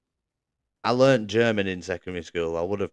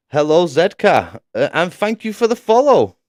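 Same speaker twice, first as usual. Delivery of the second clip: bright, much higher and louder hello to a new follower; excited greeting; 9 semitones higher, 9 dB louder than usual. Real, not acted. Streamer thanking a new follower in chat, excited. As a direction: welcome them with real excitement, pitched way up.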